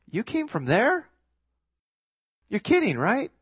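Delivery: high, disbelieving scoff; incredulous. Acted. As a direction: pitch shooting up in mock disbelief. Neighbors scoffing at the family.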